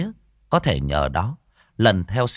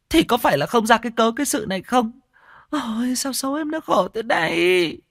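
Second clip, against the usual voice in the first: tearful wailing; 12 semitones higher than usual. A narrator's reading. Wife laments over her unconscious husband, wailing tearfully. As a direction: whine and wail over your poor husband. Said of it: "đứng ỉ ôi khóc lóc".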